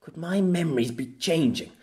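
lamenting. A narrator's reading. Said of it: "he lamented".

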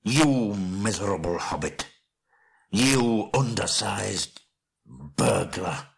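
slow, seething dwarf rage; drawn-out, outraged name-calling; furious insult. Acted. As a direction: spit out each insult slowly. Thorin rages at Bilbo.

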